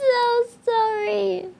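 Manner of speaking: high, slow apology; drawn-out apology. Real, not acted. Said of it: "Was it loud?".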